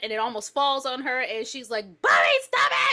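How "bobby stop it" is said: high, loud shriek of outrage; panicked, hammed-up yell; terrified mother screaming. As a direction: scream it at the child. Mother screaming after near miss.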